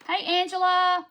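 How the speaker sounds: high, loud happy hello; loud cheerful shout-out to a viewer; excited greeting. Real, not acted. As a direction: greet a friend joyfully.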